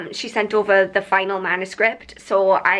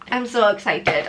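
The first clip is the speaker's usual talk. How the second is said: ordinary talk, nothing marked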